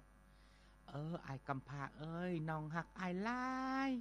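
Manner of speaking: put-on girl's loving voice. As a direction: croon it tenderly as a young girl. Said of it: "เป็นเสียงของนางเองนั่นแหล้ว".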